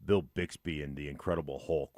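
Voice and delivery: usual speaking voice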